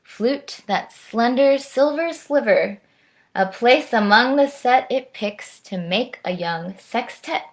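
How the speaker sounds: lilting, rhyming story narration; lively children's storytime voice; picture-book read-aloud. A narrator's reading. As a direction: read the verse expressively to children.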